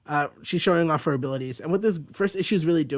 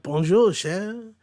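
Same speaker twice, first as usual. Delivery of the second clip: hammed-up Cajun greeting, sliding up; mock Cajun charm; Cajun accent; 5 semitones higher, 3 dB louder, much slower than usual. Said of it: "it's doing stupid accents".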